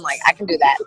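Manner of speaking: playfully put-on American accent; mock American accent. Coach teasing Rachel about accents, mock American accent. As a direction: offer up a pretend American accent. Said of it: "Do you want me to be American?".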